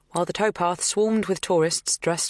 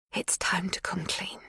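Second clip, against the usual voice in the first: whisper; 4 semitones higher, 6 dB quieter than usual. A narrator's reading. Magda whispers confession.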